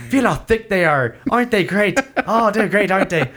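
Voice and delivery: high-pitched, giggly mock pride; hammed-up chirpy enthusiasm; happy welcoming local. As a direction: gush over them like an eager host. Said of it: "they're very happy".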